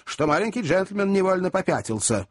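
usual speaking voice

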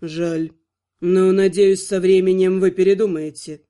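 low silky vampire purr. A narrator's reading. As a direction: purr it unbothered. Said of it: "мурлыкнул Артур".

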